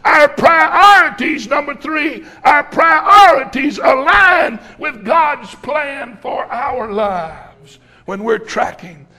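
loud, drawn-out sermon cadence; full-force preaching. Acted. Preacher driving his point home to the congregation, loud and slow. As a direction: preach it hard to the church, every word stretched.

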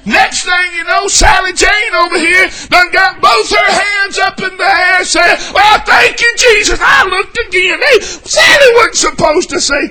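high, loud, worked-up tattling; hammed-up indignant church member; whiny scandalized complaint; mock complaining deacon.

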